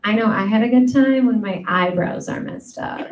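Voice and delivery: ordinary talk, nothing marked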